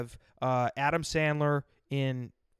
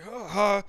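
imitated upset huffs; 6 semitones higher, 5 dB louder than usual. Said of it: "he's like".

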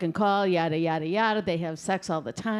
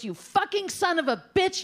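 high-pitched cursing, mock fury; acted outrage; 11 semitones higher than usual. Brother storming up to punch Bob, acted outrage. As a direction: curse him out, pitch jumping up.

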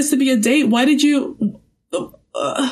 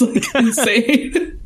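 laughing; much slower than usual. Real, not acted. Laughing at the show's jobs.

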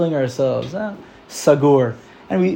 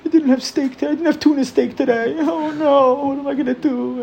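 high, whiny voice, far above normal; hammed-up spoiled moaning; mock spoiled whine; 10 semitones higher than usual. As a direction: whine like a pampered complainer over lost steak. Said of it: "We think, like".

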